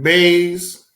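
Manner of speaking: BAYS with a z ending in place of th; bays for bathe. Retold or imitated. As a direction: say bathe as BAYS, buzzing z at the end. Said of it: "I can't do that".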